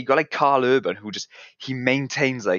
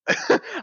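high burst of laughter. Real, not acted. Laugh after impression joke.

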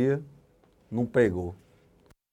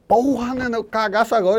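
disgusted exclamation; 8 semitones higher, 4 dB louder than usual. Retold or imitated. Abre a porta do quarto fedendo.

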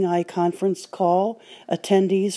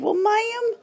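much higher, slow southern drawl; southern gentleman; 12 semitones higher, slower than usual. Retold or imitated. Southern man asking if the guide dog reads signs, high slow drawl. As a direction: ask it in a sweet, clueless southern drawl. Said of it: "a nice southern sounding, uh, gentleman said".

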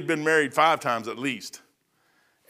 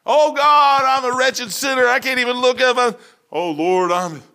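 high, loud hammed-up wailing; mock pious prayer; 8 semitones higher, 6 dB louder than usual. Preacher mocking a showy sinner praying: high hammed-up wail. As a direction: lay the false humility on thick.

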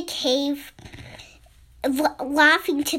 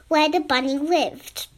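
ordinary delivery, nothing marked

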